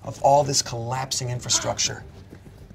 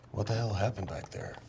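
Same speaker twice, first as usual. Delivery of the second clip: quiet question; 4 semitones lower, 12 dB quieter than usual. Acted. Gunther asking after silence.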